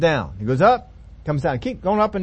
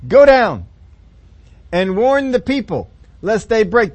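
louder, higher order; booming command; 3 semitones higher, 6 dB louder, slower than usual. Acted. God insisting Moses warn the people, louder. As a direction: insist firmly.

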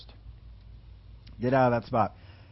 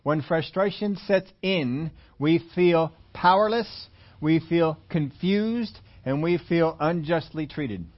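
slow dictation; slower than usual. Shown as a demonstration. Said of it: "You can write this down".

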